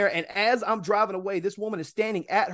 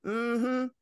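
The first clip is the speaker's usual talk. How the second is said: higher put-on doubting voice; skeptical neighbor voice; 7 semitones higher, slower than usual. Neighbor disbelieves driver's explanation, high mock skepticism. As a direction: doubt him as the nosy neighbor. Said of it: "there's a neighbor".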